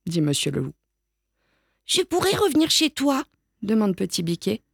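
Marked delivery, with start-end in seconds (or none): 1.9-3.2 put-on high little-kid question; asking child. A narrator's reading. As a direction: ask hopefully like a small child. Small child asking wolf.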